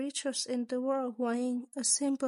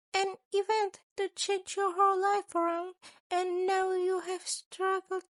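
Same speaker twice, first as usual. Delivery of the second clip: higher, mock-polite scheming principal voice; a sly creature principal, amused and menacing; 8 semitones higher, 4 dB louder than usual. A narrator's reading. Principal teasing his student with a sly higher voice. Narrator voices Nezu again.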